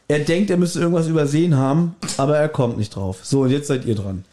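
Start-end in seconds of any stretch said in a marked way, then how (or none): none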